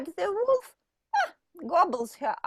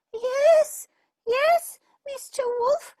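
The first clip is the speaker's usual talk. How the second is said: put-on much higher, louder frightened girl; scared little girl; 6 dB louder than usual. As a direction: squeak it out in fright. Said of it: "even more scared".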